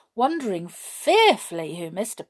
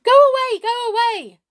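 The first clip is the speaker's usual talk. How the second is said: high, loud, panicked shout; desperate, frightened plea at full voice; frightened cry; 10 dB louder than usual. A narrator's reading. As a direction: shout it high like a scared boy. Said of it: "cried the frightened boy".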